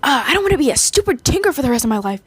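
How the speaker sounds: fast, whiny, high complaint; whiny fairy, rushed; petulant Tinkerbell. Retold or imitated. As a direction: sulk it out like a fed-up fairy. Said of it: "she was like".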